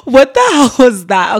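real amused outburst; laughing disbelief. Real, not acted. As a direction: crack up at your own silly voice. Laughing at her own accent.

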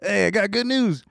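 high, hyped bro doctor; frat bro. Retold or imitated. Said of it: "imagine if he started the call like this".

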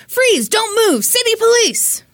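high, drawn-out shouted police command; police yell. Acted. Officer yelling at a fleeing suspect, high loud shout. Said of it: "Officer Tom yelled".

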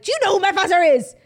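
high, loud imitation of an entitled tantrum; hammed-up indignant shout; mock breakdown. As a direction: yell it like a clubgoer refused at the door. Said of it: "having a fucking mental breakdown".

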